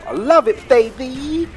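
high, loud gleeful cheer; crowing over his kills to viewers, loud and high; excited yell. Real, not acted. Gamer whooping to viewers over a kill, high and loud.

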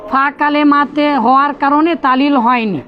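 ordinary delivery

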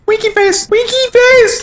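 very high squeaky cartoon voice; squeaky winky voice. Shown as a demonstration. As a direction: push it way up high. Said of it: "I don't know how to get my voice that tone".

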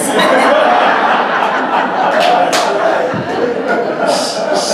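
hearty breathy laugh; loud laugh. Real, not acted. Laughs at just cause joke.

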